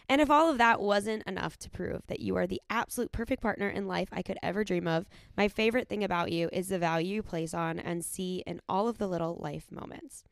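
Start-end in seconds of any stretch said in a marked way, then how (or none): none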